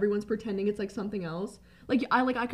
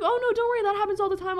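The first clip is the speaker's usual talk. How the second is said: high, light imitation of a reassuring cashier; retold reassurance; 9 semitones higher, faster than usual. Imitating a cashier reassuring an embarrassed customer, high-pitched. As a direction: lift the voice well up for the cashier's quote.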